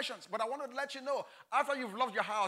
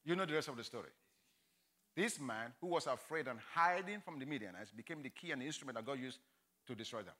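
much softer, low earnest appeal; quiet closing appeal; 7 semitones lower, 11 dB quieter than usual. Real, not acted. Preacher closes the sermon to the congregation, soft earnest appeal. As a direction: draw them in, softly.

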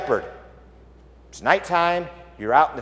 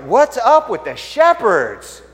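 higher, drawn-out bystander's question; puzzled townspeople's question; 5 semitones higher, 4 dB louder, slower than usual. Acted. Townspeople watching screaming shepherds run past, puzzled. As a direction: ask it slowly, bewildered by the commotion. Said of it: "everybody's like".

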